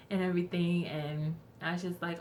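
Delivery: ordinary delivery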